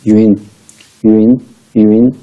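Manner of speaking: यूएन said as "yoon" with rising tune; say yun. Shown as a demonstration. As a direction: model यूएन clearly. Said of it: "इसको पढ़ते हैं यूएन".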